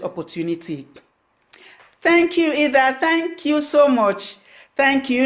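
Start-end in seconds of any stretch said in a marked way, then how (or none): 2.0-5.2 high, loud, delighted thank-yous; bright thanks. Real, not acted. Host thanking live viewers, high and delighted. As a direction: beam at the viewers as you thank them.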